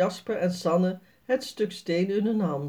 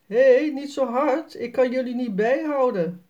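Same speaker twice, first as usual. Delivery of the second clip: calling out; 5 semitones higher, 4 dB louder than usual. A narrator's reading. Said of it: "Jaspers vader roept".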